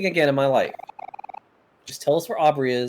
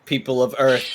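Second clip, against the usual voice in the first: ordinary talk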